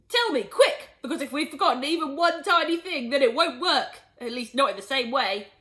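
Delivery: urgent plea. Acted. Father urgently demands.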